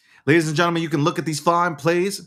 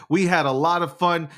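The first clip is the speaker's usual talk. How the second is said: ordinary talk, nothing marked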